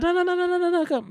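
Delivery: very high, rapid string of refusals; high hammed-up backpedalling; mock panicked denial. Voicing intruder's panicked denial.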